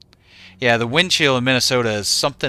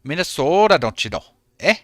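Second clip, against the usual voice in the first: light Minnesota accent impression; 3 semitones higher than usual. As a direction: toss off a jokey Minnesota accent. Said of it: "That was a Minnesota accent".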